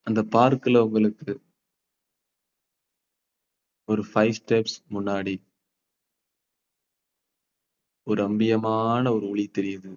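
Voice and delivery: slow, soft meditation voice; guided meditation. Acted. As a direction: paint the calm scene slowly. Guided visualization in park.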